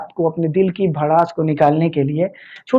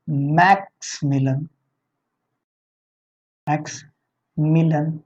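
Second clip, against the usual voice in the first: slow dictation; 4 dB quieter, much slower than usual. Shown as a demonstration. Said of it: "dictating the leader's name".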